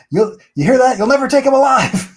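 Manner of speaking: high, loud hammed-up yell, fast at the end; mock yell. Comic character yelling at his pursuers, mock yell. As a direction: belt it out like a cartoon cowboy's defiance. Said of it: "and then he yells".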